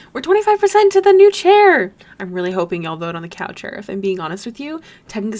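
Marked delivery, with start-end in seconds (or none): 0.1-1.9 high, loud burst of celebration; excited announcement. Real, not acted. Streamer celebrating progress toward a new chair, excited. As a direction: cheer the news out, pitched way up.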